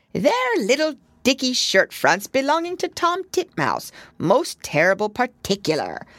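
high, bobbing little washerwoman's voice; little hedgehog laundress curtsying to Lucy. A narrator's reading. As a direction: answer with a quick curtsy, eager to please.

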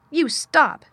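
high, loud, furious command; almost shout it to cut off the screaming; near shout. A narrator's reading. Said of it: "she almost shouted".

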